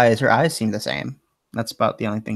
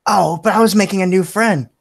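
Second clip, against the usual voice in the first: high, louder, mildly pleading protest; 8 semitones higher, 5 dB louder than usual. Acted. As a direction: object like someone being dragged away. Mother protests being taken away.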